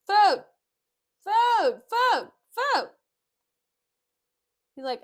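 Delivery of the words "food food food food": high, loud bursts, each falling; shouted demand. Acted. Petruchio calling servants for supper, shouting high and loud. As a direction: bellow each word separately for service.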